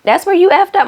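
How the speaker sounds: high and loud on effed up; emphatic repeat. Real, not acted. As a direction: hammer the line home in disbelief. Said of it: "repeated with emphasis".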